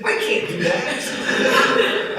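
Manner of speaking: very slow, breathy laugh through the words; retelling his laughing refusal to his wife; laughing protest. Retold or imitated. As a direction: plead helplessly through a laugh. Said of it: "I said".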